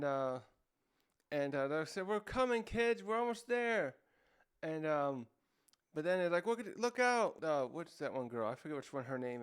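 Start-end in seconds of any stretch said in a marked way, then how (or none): 2.1-4.0 calling out. Retold or imitated. Rescuers calling to kids. 6.5-7.4 warning shout. Retold or imitated.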